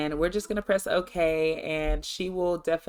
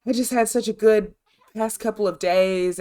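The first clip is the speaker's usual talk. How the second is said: imitating her excitement, higher at first, then louder; lightly acted excited vlogger; super excited; 4 semitones higher, 5 dB louder than usual. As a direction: gush mildly on her behalf. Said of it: "just super excited".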